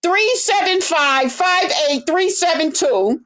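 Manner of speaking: high, mocking voice of nosy fans; sarcastic impression of chat asking to pull up a video; mocking nosy viewers. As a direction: parody the viewers who demand the exact timestamp. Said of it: "people gotta go".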